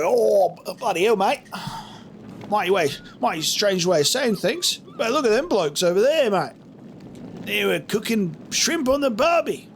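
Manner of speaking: high, hammed-up Australian accent. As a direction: ham up a cartoon Australian. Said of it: "a bit stereotypical".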